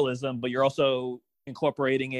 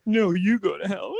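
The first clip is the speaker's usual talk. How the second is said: loud, higher playful retort; mock indignant comeback; 6 semitones higher, 5 dB louder than usual. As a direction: fire it back in pretend outrage. Playing the fire's comeback.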